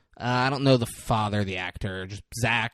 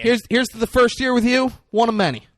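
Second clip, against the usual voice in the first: cheerful toast; 9 semitones higher, 6 dB louder than usual. Real, not acted. Podcast anniversary toast.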